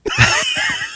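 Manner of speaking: sudden high-pitched laugh. Real, not acted. Laughs at the joke.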